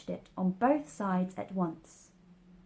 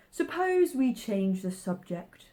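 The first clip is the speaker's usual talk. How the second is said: yawning; 4 semitones higher, 4 dB louder than usual. Acted.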